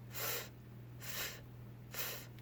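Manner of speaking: soft breathy f sounds, like a fish; letter sound f. Shown as a demonstration. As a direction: push air through your teeth for "F". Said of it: "do our little fins like this and say".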